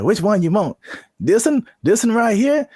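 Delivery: high, drawling country farmer voice. Retold or imitated. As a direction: play a pushy country farmer. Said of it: "there's this country farmer".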